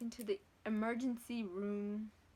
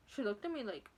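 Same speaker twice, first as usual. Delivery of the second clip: ordinary talk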